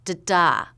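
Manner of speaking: "Da-da" with a weak first beat and strong second; short da, then longer stressed DA; second-syllable stress. Shown as a demonstration. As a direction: hit the second "da". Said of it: "with stress on the second syllable".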